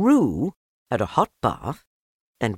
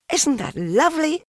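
put-on high, bright little boy voice; high, eager child's voice; young Christopher Robin; 4 dB louder than usual. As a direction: lift it up like a kind small boy.

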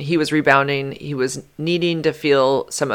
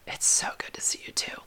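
voiceless whisper, very soft; 13 dB quieter than usual. Retold or imitated. Voicing him whispering back, lips to lips. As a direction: hush it right back.

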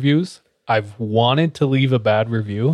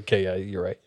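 soft, lower retold surrender; meek concession; 5 semitones lower, 10 dB quieter than usual. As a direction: give in quietly, imitating yourself backing down. Retells giving in to wife.

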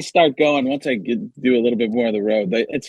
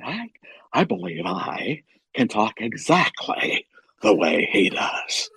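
slow, deliberate actor impression trailing into whisper; Paul Giamatti; 3 dB quieter, slower than usual. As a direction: draw out every phrase, then fade away. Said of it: "I was watching and going".

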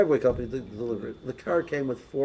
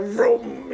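mock engine vroom; 8 semitones higher, 4 dB louder than usual. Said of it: "And he's just like".

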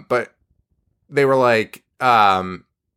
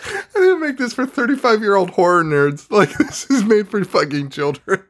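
a higher, laughing, put-on voice of an offended creator; mock film creator; 7 semitones higher than usual. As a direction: scold the horror nerds in a hammed-up voice. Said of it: "imagine the creators of this listening to us and be like".

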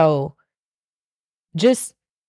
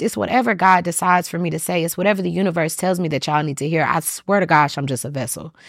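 ordinary delivery

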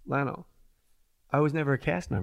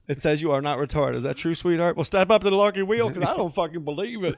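louder, higher hammed-up host; game show host; 4 semitones higher, 3 dB louder than usual. Comic riffing a fake game show host, loud and hammy.

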